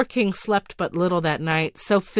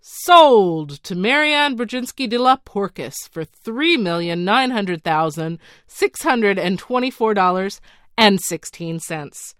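crying out; 6 dB louder than usual. Acted. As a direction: call the final sale out loud. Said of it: "ending the auction by crying out".

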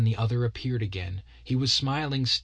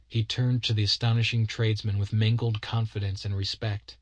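plain reading voice

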